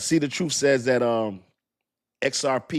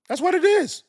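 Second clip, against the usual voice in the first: much higher, louder exclamation; high exclaim; 5 dB louder than usual. Real, not acted. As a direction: throw it up high and loud. Exclaimed, then restated.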